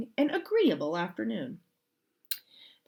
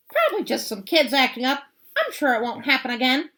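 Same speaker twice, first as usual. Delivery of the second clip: fast, loud breezy dismissal; breezy Swamp; 7 semitones higher, 7 dB louder, faster than usual. Acted. Miss Swamp dismissing the principal, quick and breezy. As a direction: brush it off quickly.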